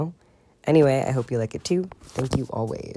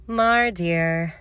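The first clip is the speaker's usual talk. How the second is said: bored; 6 semitones higher, 4 dB louder than usual. Acted. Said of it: "sounding bored".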